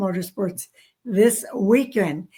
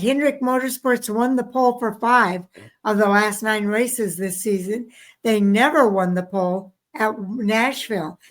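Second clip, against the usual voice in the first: usual speaking voice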